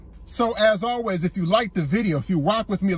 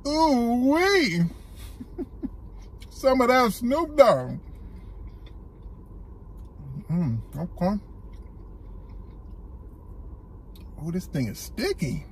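talking between chews; savoring food while speaking; mouth full, chewing; 3 semitones higher, 5 dB quieter, slower than usual. Real, not acted. Seen 0: He bites at -12, then chews with pursed lips throughout.

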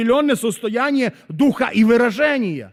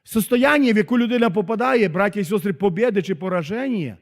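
normal talking voice

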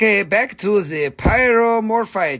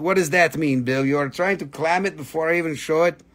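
ordinary talk

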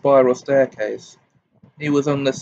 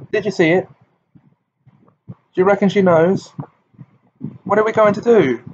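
higher, louder eager questions; excited; 7 semitones higher, 4 dB louder, faster than usual. A narrator's reading. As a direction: pepper him with urgent questions. Group anxiously pressing Harry.

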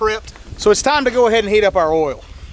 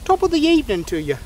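put-on a light, higher stab at an Irish accent; mock Irish accent; 9 semitones higher than usual. Vlogger asked how to do Irish, trying a light mock Irish accent. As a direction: toss off a playful Irish accent, greeting-style. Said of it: "How would you do an Irish accent?".

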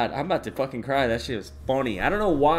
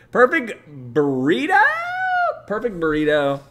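excited; 8 semitones higher, 6 dB louder than usual. Real, not acted. Excited repeating question.

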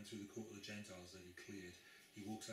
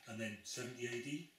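normal talking voice

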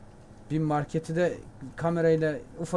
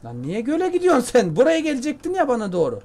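exasperated outcry; 8 semitones higher, 6 dB louder than usual. Real, not acted. Rabbit runs off toward the lake.

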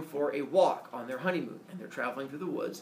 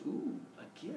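tempted damsel; 11 semitones higher, 9 dB quieter than usual. Acted. Daenerys voiced tempted.

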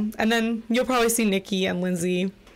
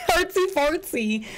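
silly high voice; 7 semitones higher than usual. Pretend. Repeats phrase in high voice.

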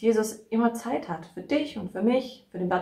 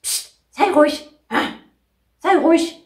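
mock telling off; 8 semitones higher, 8 dB louder than usual. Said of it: "hab ich mich aufgeregt über die".

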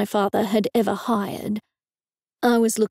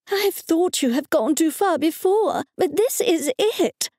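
high, indignant mother's outburst; outraged exclamation; 7 semitones higher than usual. Acted. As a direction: explode in shocked outrage. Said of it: "she exclaimed".

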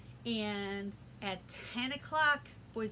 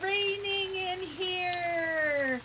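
excited greeting; 7 semitones higher, 9 dB louder, slower than usual. Acted. Teacher playing in rain.

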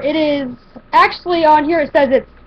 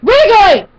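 real sharp yell at the cat, higher and louder; calling out a pet's name to stop him; 4 semitones higher, 4 dB louder than usual. Real, not acted. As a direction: call the cat off loudly. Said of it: "I gotta yell at my cat".

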